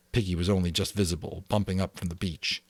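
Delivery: plain reading voice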